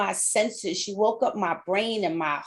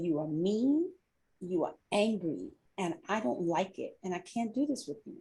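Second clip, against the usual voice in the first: hushed, much softer, near tears; retold tearful plea; 7 dB quieter than usual. Coworker Lisa telling her boss she can't go on, hushed and tearful. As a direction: soften right down as if about to cry. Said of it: "she started crying".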